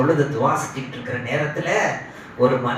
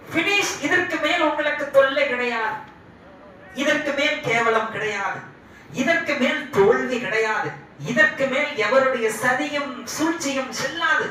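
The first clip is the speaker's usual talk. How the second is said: high-pitched, ringing proclamation; emphatic assurance; 9 semitones higher than usual. Retold or imitated. Preacher voicing God's promise of no more trouble, emphatically. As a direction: declare each promise with rising fervour.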